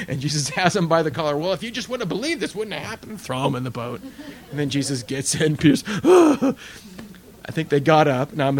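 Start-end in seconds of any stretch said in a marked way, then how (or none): 1.4-3.2 higher, quick, teasing rebuke; mock scolding Jesus. Jesus gently scolding a rescued Peter. 5.9-7.1 sharp, high, loud startled gasp; acted gasp. Peter sinking, acted gasp.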